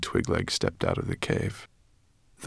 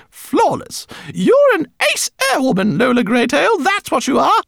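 squeaky, loud self-praise; high boastful rat voice; 10 dB louder than usual. Acted. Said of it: "heard her comment".

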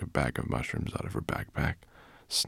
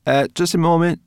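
high, loud objection; interrupting; 13 semitones higher, 10 dB louder than usual. A narrator's reading. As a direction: cut in as a suspicious brownie. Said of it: "Sorrel interrupted".

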